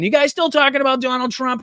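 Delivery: high, loud put-on New York accent; mock someone in a squeaky New York accent. Host mimicking Ghislaine Maxwell, wrongly in New York accent. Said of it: "I did a New York accent".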